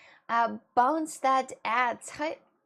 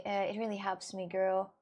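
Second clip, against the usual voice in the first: ordinary delivery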